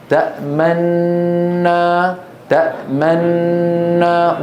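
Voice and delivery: تأمنا very slow, long ghunna, lips rounding; correct ishmam. Shown as a demonstration. Teacher slowly models correct ishmam in تأمنا. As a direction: draw out تأمنا with held nasal and rounded lips. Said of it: "ولا نقول تأمنا".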